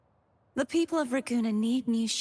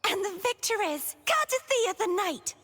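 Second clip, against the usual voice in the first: high-pitched playful victory call; triumphant announcer; 9 semitones higher than usual. Pretend. Knight declaring herself victor in a pretend battle, triumphant announcer. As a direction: proclaim the winner high and gleefully.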